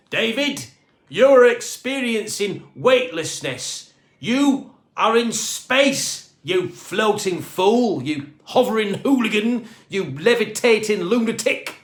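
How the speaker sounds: high, loud, slow berating; Mister Grumbleshaw berating Dave over the radio; exasperated scolding. A narrator's reading. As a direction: spell out each insult with mounting fury. Ground control scolds over radio.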